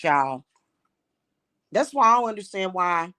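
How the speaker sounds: usual speaking voice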